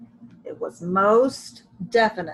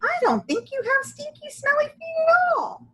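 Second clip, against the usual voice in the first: high, bright little-lady voice, quick then louder; Dolores voice; 6 dB louder than usual. A narrator's reading. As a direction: play Dolores delighted, higher voice.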